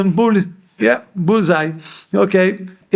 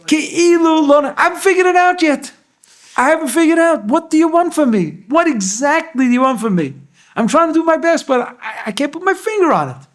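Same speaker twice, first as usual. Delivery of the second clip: higher, strained plea to God; a pleading cry upward, voice raised high; anguished plea; 9 semitones higher than usual. Acted. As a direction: ask God in frustration what he wants. Voicing a frustrated plea to God.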